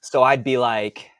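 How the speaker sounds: ordinary talk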